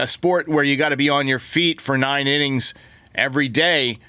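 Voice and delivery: usual speaking voice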